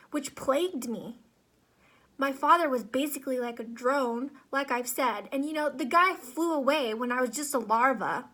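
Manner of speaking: worked-up, stammering dramatic complaint; Z, neurotic ant. Acted. Worker ant complaining to his psychologist, dramatic neurotic monologue. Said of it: "a dramatic monologue of an ant named Z".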